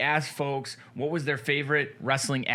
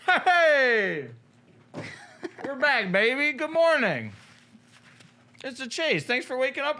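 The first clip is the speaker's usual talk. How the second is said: excited greeting; 12 semitones higher, 4 dB louder than usual. Real, not acted. Host opens the live show.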